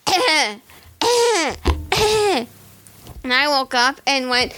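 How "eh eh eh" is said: high barked "eh"s to rouse a sleeper; ticket taker waking her. Retold or imitated. Retelling the ticket taker waking her on the train: high barking "eh"s. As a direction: poke a sleeping passenger awake with your voice.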